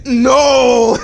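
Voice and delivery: high, loud villain shout. Acted. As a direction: yell it in defeat. Villain defeated, pot again.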